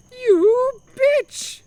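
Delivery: very high, loud hammed-up Italian scream; mock scream. Humdinger screaming at the window woman as she leaves. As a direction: scream it, shaking a fist. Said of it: "he screamed".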